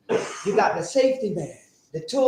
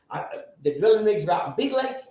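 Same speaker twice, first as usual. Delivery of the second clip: usual speaking voice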